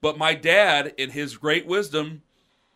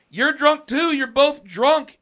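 higher, louder, slow retort; dad's retort; 11 semitones higher, 9 dB louder, slower than usual. Retold or imitated. Dad telling off the drunk brothers. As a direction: hammer it home slowly.

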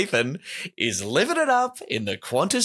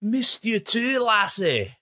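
high, hammed-up Scottish brogue; Scottish accent; 8 semitones higher, much slower than usual. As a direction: coo it in a broad Scottish accent. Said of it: "That was a pretty good Scottish accent".

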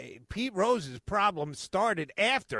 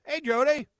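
high loud friendly hail; cheerful call; 13 semitones higher, 10 dB louder than usual. Retold or imitated. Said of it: "And he goes".

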